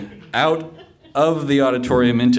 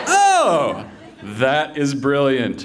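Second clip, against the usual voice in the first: high "oh", then slow wonder; amazed reaction; 3 semitones higher, much slower than usual. Retold or imitated. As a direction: act out a bowled-over friend. Second friend amazed, acted out.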